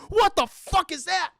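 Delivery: high, loud startled cry; startled yell. Retold or imitated. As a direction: blurt it out in shock. Cop startled by flying gargoyle.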